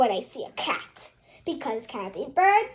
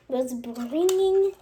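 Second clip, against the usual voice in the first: ordinary delivery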